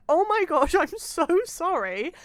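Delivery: high, loud flustered gush. Retold or imitated. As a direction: blurt an embarrassed apology. Retold flustered apology.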